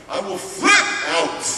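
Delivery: angry threat. Retold or imitated. Boy warning he will lose it, angry threat. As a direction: threaten them, pitch jumping up. Threatens counselors in retold scene.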